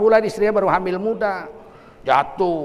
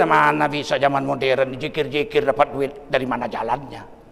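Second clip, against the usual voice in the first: low, softer mocking skeptic's voice; doubting skeptic; 9 semitones lower, 4 dB quieter than usual. Preacher mocking the modern skeptic, low and dismissive. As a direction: mimic the doubter, dropping low. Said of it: "Apa kata akal kita?".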